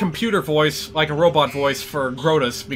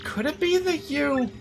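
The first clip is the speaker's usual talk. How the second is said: princess voice; 5 semitones higher, 4 dB quieter than usual. Acted. Peach flustered by computer.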